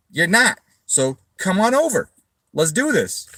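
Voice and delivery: goading a rival to show up and fight; mock fighting challenge. Retold challenge to online threatener.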